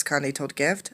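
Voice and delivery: ordinary delivery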